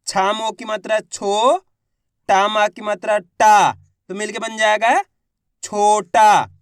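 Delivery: syllable by syllable, then blend छोटा; sounding out. Shown as a demonstration. Teacher shows students how to blend छोटा, sounding out. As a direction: say छो, then टा, then join them into छोटा. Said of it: "मैं किस तरीके से एक एक अक्षर का उच्चारण करके इनको पढ़ रहा हूँ".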